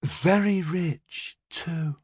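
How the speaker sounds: tipsy, slow and slurring; a little drunk, words blurring; slightly slurred. A narrator's reading. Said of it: "in a slightly slurred voice".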